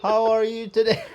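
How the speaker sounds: lightly voiced high robot; a touch of toy robot voice. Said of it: "This looks like a robot".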